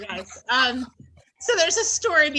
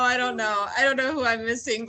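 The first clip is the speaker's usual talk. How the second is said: ordinary delivery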